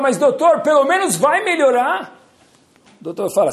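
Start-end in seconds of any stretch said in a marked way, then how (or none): 0.0-2.2 high, loud, drawn-out plea; desperate plea. Acted. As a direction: beg for any hope, desperate. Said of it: "O rei desesperado fala". (rei desesperado suplica ao médico, alto e arrastado)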